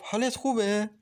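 bubbling over with joy on the phone; happy. A narrator's reading. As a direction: Maryam gushing happily to Masoud. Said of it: "مریم با خوشحالی گفت".

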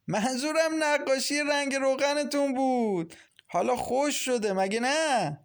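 very high, stretched-out villainous taunt; gloating, creepy glee. A narrator's reading. Mashallah teasing Farzaneh about oil paintings, creepily gleeful. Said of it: "با خندهٔ وحشتناکی گفت".

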